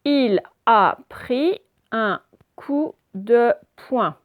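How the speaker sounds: "Il a pris un coup de poing" very slow, each syllable separate; deliberate teacher's decoding of "Il a pris un coup de poing"; syllable-by-syllable reading. Shown as a demonstration.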